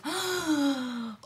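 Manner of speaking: a sharp high gasp of feigned shock; mock gasp. To the ticket inspector, a put-on gasp. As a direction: gasp as if caught out.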